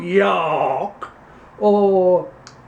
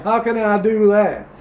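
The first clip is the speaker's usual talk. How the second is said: put-on Louisiana accent. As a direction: play a pompous Louisiana candidate, Southern accent. Same character continues.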